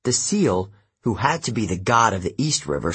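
plain reading voice

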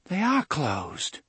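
pettish. A narrator's reading. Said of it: "a trifle pettishly".

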